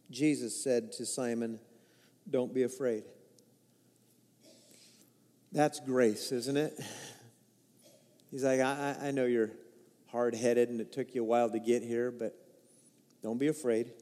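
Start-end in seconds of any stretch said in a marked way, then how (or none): 2.3-3.0 gentle reassurance. Acted. Jesus comforting Peter. 8.6-13.9 soft reassurance. Acted. Jesus comforting Peter.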